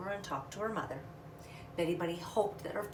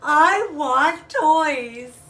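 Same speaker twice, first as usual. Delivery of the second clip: higher, louder tearful wail, slow; through tears; 8 semitones higher, 14 dB louder, much slower than usual. Acted. Little bunny crying to her parents, loud tearful wail. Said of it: "she said through her tears".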